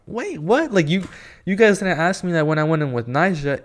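high-pitched incredulous protest; retold bewilderment. Fan stopped by security, high-pitched baffled protest. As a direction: start very high in disbelief, then settle slightly.

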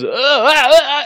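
high, dopey stunned uhs; mock dazed groan. Go-kart driver tipping over, high dazed groans. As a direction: play a man knocked silly. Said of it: "and then he goes".

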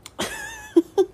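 a sudden shrill laugh way up high; high squeal of laughter. Real, not acted. Amused by her own phrase, squealing laughter.

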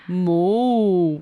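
long drawn-out moo; low like a cow, slowly; Japanese cow sound. Shown as a demonstration. Co-host shows how cows sound in Japan, slow moo. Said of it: "say that in Japanese way".

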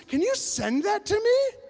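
high, loud, drawn-out eager plea; eager request. Retold or imitated. Woman asking him to send the video, excited.

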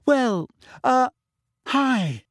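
put-on high, nervous girl's voice. A narrator's reading. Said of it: "her voice slightly higher than usual out of nerves".

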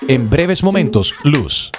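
steady automated recorded-message voice; phone menu voice. Acted. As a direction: read it like a robotic phone menu.

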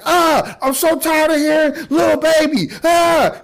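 high, loud mock-exasperated yell; hammed-up cry of frustration; fed-up outburst. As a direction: act out losing patience with the radio. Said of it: "he threw the radio like".

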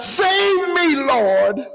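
high, drawn-out call to the Lord; hollered cry for help. Retold or imitated. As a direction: holler it out as a retold plea. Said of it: "And he hollered out".

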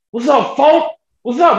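high hollered greeting across campus; mock holler. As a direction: call it out like band boys greeting. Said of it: "hearing them boys hollering".

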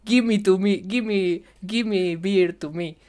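high, loud broken English. Retold or imitated. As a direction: blurt out clumsy English orders.

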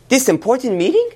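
high, rising incredulous question; surprised question. Acted. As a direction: ask it in wide-eyed surprise. Doorman surprised by the meeting.

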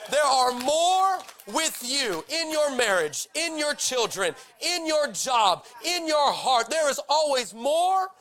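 preacher declaring over the congregation; full-force preaching. Acted. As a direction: build it like a sermon peak. Preaching application.

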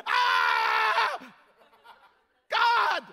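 hammed-up scream at God; mock panic yell. Pastor mocking his panic at a cloud, loud yell. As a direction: yell in fake terror, high and loud. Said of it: "a wisp of cloud come up".

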